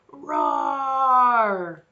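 put-on teacher leading children in a lion roar. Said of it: "Can you guys roar with me?".

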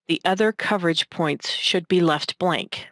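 ordinary talk, nothing marked

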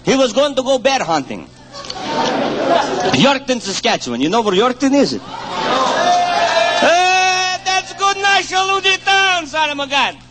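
put-on broken-English Ukrainian immigrant accent telling jokes; thick Ukrainian accent, dropped articles. Comedian telling hunting jokes to an audience in a Ukrainian accent.

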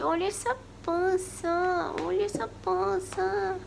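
slow high cooing voice; drawn-out pet talk. Real, not acted. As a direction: coo over the cat's belly. Cooing at cat's belly.

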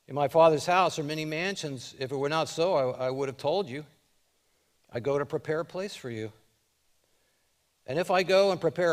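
loud, high preaching of the verses; fervent scripture reading. Acted. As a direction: proclaim the scripture with full force. Preaches Jesus' words at full force.

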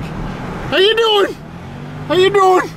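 very high put-on hello; high squealed greeting. Greeting an old fucker on his rig in a high squeal. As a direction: hail them in a squeal.

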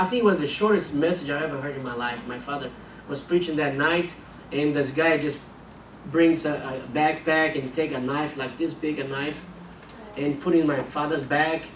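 usual speaking voice

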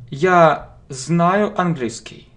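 normal talking voice

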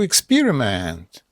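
ordinary delivery

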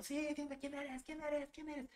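high-pitched, hushed mock yell; retold shouting. Naked group shouting in a ritual, high soft mock yell. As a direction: imitate a shouting chorus in a small high voice. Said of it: "Todos desnudos gritando".

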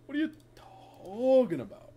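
usual speaking voice

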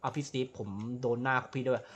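ordinary delivery

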